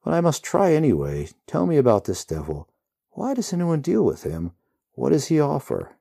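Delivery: lightly voiced determined hero. A narrator's reading. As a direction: press for answers. Hero's voice in story.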